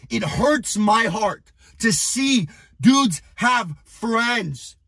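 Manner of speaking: ordinary delivery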